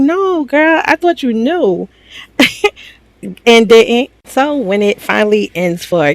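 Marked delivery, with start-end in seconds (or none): 0.0-1.9 high-pitched, drawn-out retold reaction; mock disbelief. Friends telling Kata about the missing girl, in high mock disbelief. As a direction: stretch it out high, slower at the end.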